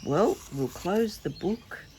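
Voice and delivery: ordinary delivery, nothing marked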